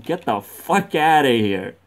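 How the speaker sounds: loud, emphatic mock order to leave; exasperated yell. Player kicking the drunks out, exasperated yell. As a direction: blast them out with a punched curse.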